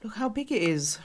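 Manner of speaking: ordinary talk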